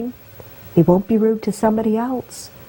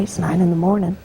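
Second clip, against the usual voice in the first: usual speaking voice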